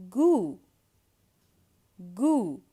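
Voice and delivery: "goût" as goo, silent t; same oo sound. Shown as a demonstration. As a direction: model "goût" loudly with a long oo. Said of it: "it's the exact same sound".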